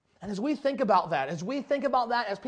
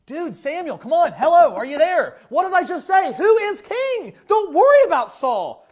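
higher, louder mock exasperation; exasperated scolding; 6 semitones higher, 7 dB louder than usual. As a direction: playfully act out God losing patience with a prophet. God scolds Samuel in retelling.

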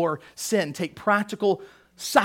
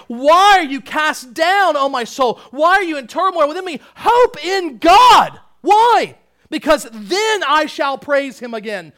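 high, loud, ringing psalm reading; full-force pulpit recitation; declaimed scripture; 6 semitones higher, 9 dB louder than usual. Real, not acted. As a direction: proclaim it forcefully, stretching the key words.

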